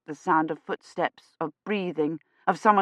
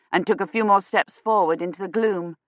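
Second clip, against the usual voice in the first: ordinary delivery, nothing marked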